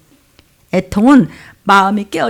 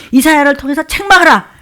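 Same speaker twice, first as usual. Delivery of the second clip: raised, commanding voice; loud rebuke; 5 semitones higher, 5 dB louder than usual. Acted. God commands a rebuke through Isaiah, loud rebuke. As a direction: deliver it as a stern order to scold. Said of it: "막 소리를 크게 해서 막 야단치라는".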